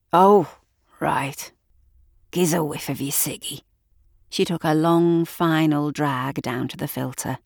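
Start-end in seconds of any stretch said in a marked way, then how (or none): 0.1-3.6 a gruff local man, half whispered; rough bloke voice. Acted. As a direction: lean in, wheedling for a smoke.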